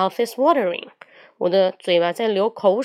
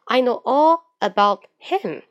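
slow drill; 3 semitones higher, 3 dB louder than usual. Shown as a demonstration. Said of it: "把it改成him".

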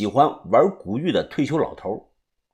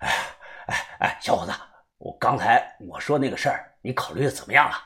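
puffing old man, softly voiced; out of breath; 4 semitones lower, 8 dB quieter, faster than usual. A narrator's reading. Old man catches up to press his offer, winded and soft. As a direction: catch your breath between the first words. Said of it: "老头也气喘吁吁的跟了上来".

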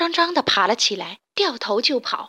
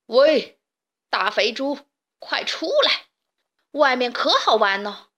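big bad wolf hollering at the door; fake-friendly wolf shouting outside; wolf calling out loud; 3 dB louder than usual. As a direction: call the pig out, loud and sly. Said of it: "他大声地喊着".

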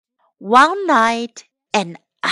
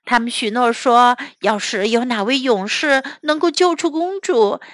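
ordinary delivery, nothing marked

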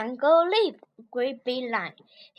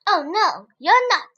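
high, louder rabbit protest; 4 semitones higher, 6 dB louder than usual. Acted. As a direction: pipe up to contradict him. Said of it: "said clever rabbit".

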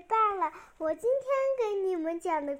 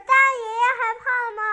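ordinary delivery, nothing marked

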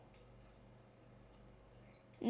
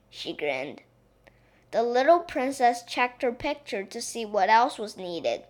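usual speaking voice

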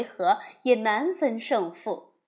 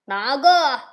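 loud call; 8 dB louder than usual. Acted. Demon king calling out to Wukong, loud shout. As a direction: shout the question across the field. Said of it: "高声问道".

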